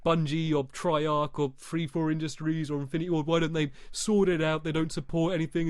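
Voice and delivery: whiny complaining gamer, lightly voiced; a hint of a whining gamer; mock moaning gamer. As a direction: imitate a grumbling player. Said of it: "They're going".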